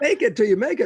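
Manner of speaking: laughing it out high and loud. Retold or imitated. Amused at the new age slogan with the host, high laughing repeat.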